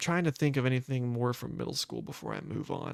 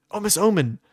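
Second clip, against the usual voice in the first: surprised recall; 9 semitones higher, 9 dB louder than usual. Real, not acted. Suddenly remembering the name.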